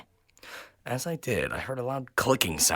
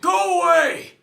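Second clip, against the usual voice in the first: high, loud panicked shout; terrified scream; 7 dB louder, slower than usual. Acted. The narrator screams at the thing outside the door, terrified scream. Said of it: "I screamed".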